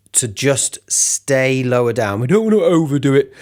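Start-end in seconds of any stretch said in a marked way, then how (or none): 2.2-3.3 dopey sound. Shown as a demonstration. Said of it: "if we do a really dopey sound".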